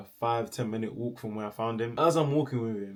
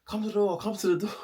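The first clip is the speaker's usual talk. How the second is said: high urgent call through the letterbox; screamed call; 10 semitones higher than usual. Retold or imitated. Calling mum through the letterbox, a high urgent yell. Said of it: "I've screamed like".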